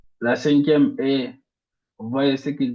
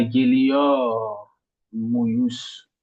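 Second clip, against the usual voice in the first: geliyor muyuz slowly, weight on geliyor; stress before muyuz; much slower than usual. Shown as a demonstration. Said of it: "l'accent tonique sur". (accent tonique sur geliyor, lentement)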